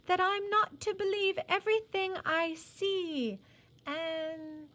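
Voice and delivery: put-on high, slow little girl. A narrator's reading. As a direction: voice her as a young girl. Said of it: "she apologized handsomely".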